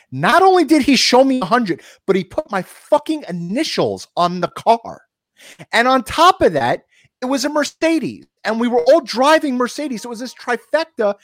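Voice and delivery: high-pitched, excited emphasis; real amazement. Real, not acted. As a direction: drive the astonishing point home, voice way up. Amazed climax of sign story.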